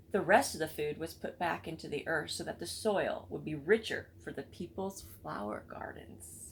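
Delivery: ordinary delivery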